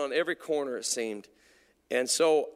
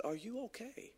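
very soft, higher-pitched question; gentle concern; 8 semitones higher, 12 dB quieter than usual. Retold or imitated. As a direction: ask softly, careful with someone in tears. Gently asks the crying clerk.

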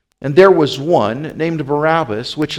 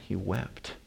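very soft, lowered voice; sorrowful hush; 5 semitones lower, 16 dB quieter than usual. A narrator's reading. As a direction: let it sink almost to silence. Peter's sorrow ends the passage.

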